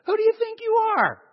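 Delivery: high mock outrage; indignant challenge. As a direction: fling it back like an offended crowd.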